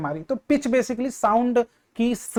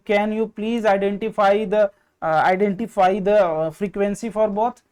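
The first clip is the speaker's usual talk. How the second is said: slow questioning; much slower than usual. Shown as a demonstration. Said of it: "poses a question to class".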